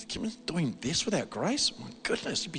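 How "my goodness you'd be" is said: "My goodness" breathed out soft and voiceless; whispered aside. Real, not acted. Preacher marvelling to himself, whispered under his breath.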